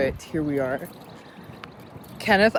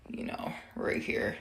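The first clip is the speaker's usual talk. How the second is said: whisper; 10 semitones lower, 12 dB quieter than usual. Real, not acted. Aside while applying bronzer.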